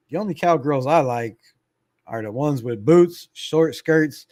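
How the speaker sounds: ordinary talk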